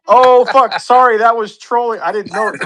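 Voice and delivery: high, panicked apology. Retold or imitated. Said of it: "And I was like".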